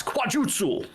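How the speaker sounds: "Quajutsu" exclaimed high; mock martial-arts cry. After explaining frog martial arts, a playful high cry of "Quajutsu".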